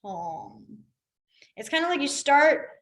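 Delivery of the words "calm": soft low "calm"; quiet calm. Shown as a demonstration. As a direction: breathe it out gently. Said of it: "like, quiet".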